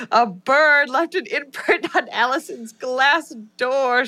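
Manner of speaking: slow, drawn-out horror reveal; mock-dramatic revelation. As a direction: stretch every word with hammed-up drama. Climax line of spooky review.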